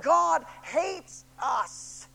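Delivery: drawn-out, very slow moaning wail. Retold or imitated. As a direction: Israelites moaning all night long, a slow drawn wail. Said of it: "All night long they moaned".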